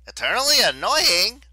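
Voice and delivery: very high, loud jeer; mocking yell. A narrator's reading. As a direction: yell the taunt over the battle. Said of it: "Leo yelled".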